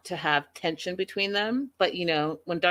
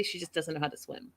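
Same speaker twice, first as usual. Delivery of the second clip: normal talking voice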